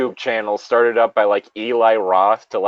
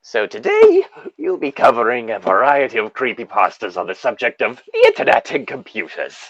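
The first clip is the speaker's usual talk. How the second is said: horror host narration. Acted. Continuing horror host intro.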